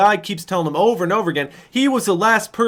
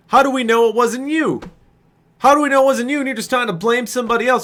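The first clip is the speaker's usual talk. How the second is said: indignant retort; 7 semitones higher, 4 dB louder, faster than usual. Retold or imitated. Arguing back at the accuser.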